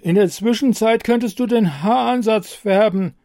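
high, loud order through a stiff face; stiff-faced command. Acted. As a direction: command it without moving your face. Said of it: "kommandierte Brigitte mit steifen Gesichtszügen".

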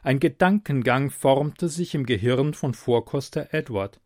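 ordinary delivery

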